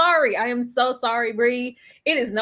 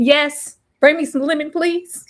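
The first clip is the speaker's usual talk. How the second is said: louder, higher, bossy request; bold demand; 3 semitones higher, 6 dB louder than usual. Retold or imitated. Restaurant guest demanding lemon, bossy and loud. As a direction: demand the lemon like you own the place.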